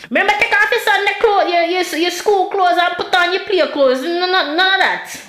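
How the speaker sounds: high, quick Jamaican scolding; Jamaican mother yelling. Retold or imitated. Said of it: "she'd yell at me like".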